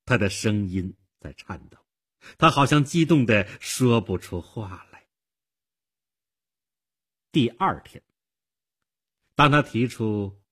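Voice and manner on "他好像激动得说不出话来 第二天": plain reading voice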